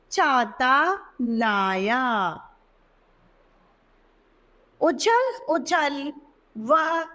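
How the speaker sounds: slow word by word, with long pauses; slow reading aloud. Shown as a demonstration. Teacher reading short sentences to young pupils. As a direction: read for children, one word at a time. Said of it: "वाक्यों को और हम पढ़ते हैं".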